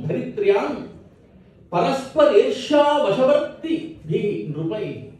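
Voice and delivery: ringing, lilting chant of verse; Sanskrit verse recitation. Acted. Poet lamenting an occupied nation, chanted recitation. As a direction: declaim it with sustained line endings.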